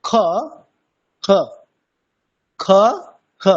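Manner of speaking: খ said a little above the full kha spot; half kha. Shown as a demonstration. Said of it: "দেখুন". (অর্ধেক খ উচ্চারণ)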